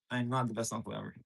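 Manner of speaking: quiet confession. Real, not acted. Streamer admitting to viewers he's a bad uncle, quiet. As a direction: mumble it like an honest admission. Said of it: "I'll be honest".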